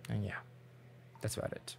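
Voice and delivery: unvoiced, trailing whisper. Real, not acted. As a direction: fade out under your breath. Trailing off awkwardly.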